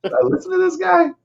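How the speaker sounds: high mock disbelief. Mocking viewer reaction.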